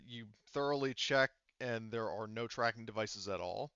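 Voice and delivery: usual speaking voice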